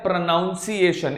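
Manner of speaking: pronunciation with "noun" as second syllable; mispronounced with NOUN. Shown as a demonstration. Teacher quoting the common error, mispronounced pronunciation as pro-noun-ciation. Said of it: "It is not pronunciation".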